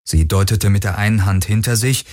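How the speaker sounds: usual speaking voice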